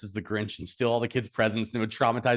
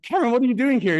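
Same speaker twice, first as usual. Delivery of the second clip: high, loud retold surprise; raised-pitch mock astonishment; surprised grandparents; 12 semitones higher, 6 dB louder, faster than usual. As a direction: imitate grandparents startled by a surprise visitor. Startled grandparents greet runaway boy.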